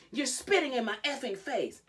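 high, loud outraged cry; hysterical. Retold or imitated. Retelling Jade protesting at Christy's face, hysterical shouting. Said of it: "Like, hysterically".

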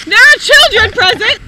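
normal talking voice

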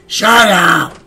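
high, loud "Shut up!"; loud joking yell; shout. Pretend. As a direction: bark it suddenly and hard. Yelling at game character.

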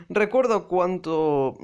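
usual speaking voice

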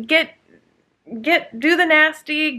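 ordinary talk, nothing marked

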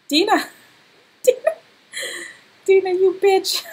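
loud, high yell through laughter; laughing, indignant shout; mock outrage. Real, not acted. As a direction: scold the character while cracking up. Mock outrage at Sim blocking Dawn.

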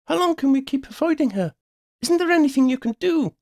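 high-pitched urgent friend; high, pressing questions mid-chase; urgent questioning. A narrator's reading. Friend questions her during chase.

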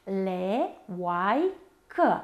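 three separate syllables: le, oai, că; slow syllable demonstration with clear pauses; despărțit în silabe. Shown as a demonstration. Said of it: "Triftongul oai". (rostit rar, pe silabe)

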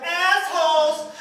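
high, stretched-out heckle; drawn-out call. Retold or imitated. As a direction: call it out long across the street. Repeats his call for laughs.

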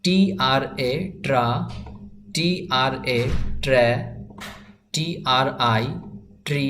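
slow letter-by-letter spelling, then the blended syllable. Shown as a demonstration. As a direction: spell each one aloud, pause, say the sound. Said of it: "প্রথমে আমরা বানান করে পড়ব".

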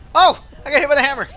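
sudden loud yelp; startled yelp. Real, not acted. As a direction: cry out when hit. Hit by the hammer.

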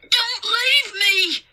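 high, slow, wailing plea; drawn-out panic; terrified child's cry. A narrator's reading. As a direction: scream it like an abandoned little boy. Boy begs not to be left.

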